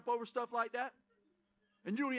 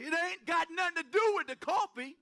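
higher, louder, fired-up comeback; fired-up retort; 5 semitones higher, 4 dB louder than usual. Retold or imitated. Preacher answering friends who tease him, fired-up and louder. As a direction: punch it out with rising excitement. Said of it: "I'm like".

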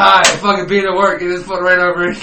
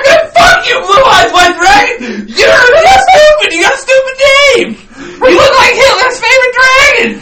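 mock furious shout; 9 dB louder than usual. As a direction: yell mock abuse at a monster. Acted tirade at an imagined card monster.

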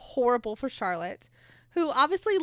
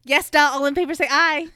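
loud bossy; 3 semitones higher, 9 dB louder than usual. Retold or imitated. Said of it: "and Elena's like".

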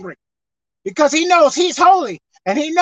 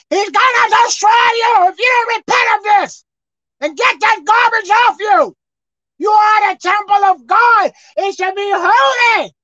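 raised, shouted sermon, pitched high; full-force preaching; 9 semitones higher, 6 dB louder than usual. Real, not acted. Preacher warning hearers who didn't get it, shouting. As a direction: preach it hard, driving up to a shout.

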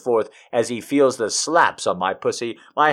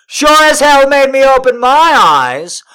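much higher and louder, startled; jolted exclamation; 13 semitones higher, 9 dB louder than usual. A narrator's reading. Narrator jolted open by the slap, high startled outburst. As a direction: burst out in shock.

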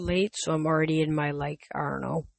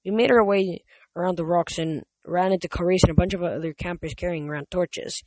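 ordinary delivery

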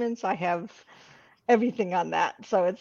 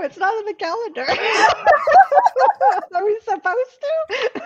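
laughing mock confusion; 11 semitones higher, 4 dB louder than usual.

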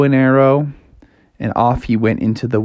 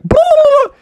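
loud, high silly gobble; goofy high turkey holler; mock turkey call; 6 dB louder than usual. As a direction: squawk out a bad turkey noise. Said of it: "making a turkey noise".

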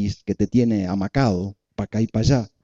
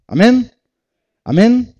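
a loud, much higher rising "Amén"; shouted amen; 12 semitones higher, 7 dB louder, slower than usual. Acted. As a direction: cry out amen in triumph. Congregation-style shouted amen.

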